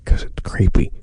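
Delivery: loud creepy stage whisper. Pretend. Co-host repeating his creepy aside, close-mic whisper. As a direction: whisper right into the microphone. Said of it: "I was whispering".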